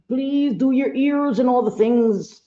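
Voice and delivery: high mock pleading voice; imitated nagging request. Viewers asking her to do her ears, mock pleading. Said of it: "they say".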